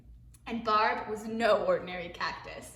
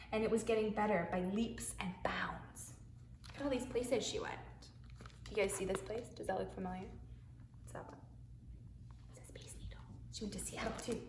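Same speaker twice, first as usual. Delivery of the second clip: quiet; 11 dB quieter, faster than usual. Real, not acted. Quiet asides to children.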